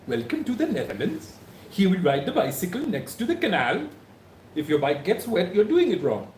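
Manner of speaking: sing-song Dutch accent with a high lilt; hammed-up Dutch tourist guide welcoming you. Shown as a demonstration. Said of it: "I can do my Netherlands accent".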